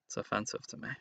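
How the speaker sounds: whispered aside. Pretend. Mock offense at opponent's card.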